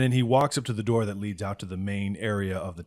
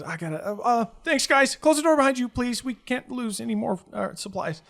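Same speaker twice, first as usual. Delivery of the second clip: hurried innkeeper; 13 semitones higher, 3 dB louder than usual. Acted.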